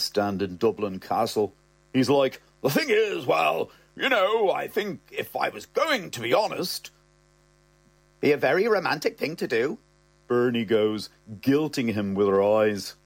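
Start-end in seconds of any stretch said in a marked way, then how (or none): 2.6-6.9 higher, halting mumble; sputtering father. Retold or imitated. Father cornered by son, halting sputter. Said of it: "muttering and sputtering". 8.2-9.8 quick, higher pointed woman's voice; Bernie's voice. Retold or imitated. Girlfriend guilting father, quick pointed voice.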